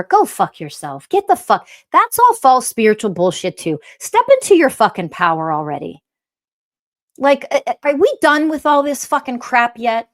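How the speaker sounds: loud, cursing, fed-up outburst; furious rant. Real, not acted. Ranting at false spirituality.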